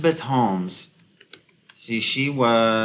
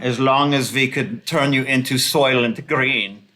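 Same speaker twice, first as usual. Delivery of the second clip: put-on Germanic villain accent; mock Klaus Schwab accent; 2 semitones higher, 4 dB louder than usual. Commentator mocking an elitist's outlook on life, villainous Germanic accent. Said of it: "he would rather see you six feet under".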